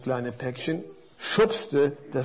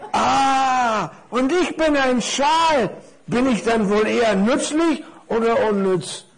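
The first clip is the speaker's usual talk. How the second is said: higher, animated storybook character; curious big parcel; 7 semitones higher, 4 dB louder than usual. Acted. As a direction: wonder aloud with lively, raised pitch. Voices the scarf. (neugieriges Päckchen, lebhaft und hoch)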